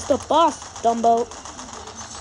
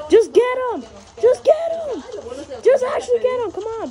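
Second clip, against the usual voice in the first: high urgent urging; 11 semitones higher, 7 dB louder than usual. Real, not acted. Urging teammate to attack.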